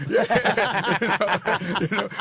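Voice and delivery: laughing through the words. Real, not acted. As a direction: chuckle while answering. Laughing at the crowd's joke.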